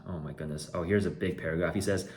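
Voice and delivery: ordinary talk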